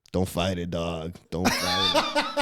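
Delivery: low, defeated fellow-prisoner voice; deadpan low imitation of a jaded detainee; resigned cellmate. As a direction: drop the pitch and advise surrender. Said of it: "He's just like".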